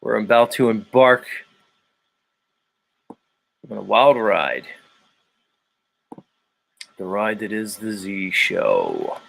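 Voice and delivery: measured, drawn-out intro with long pauses; show-opening announcer. Acted. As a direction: announce the show like a ride about to depart.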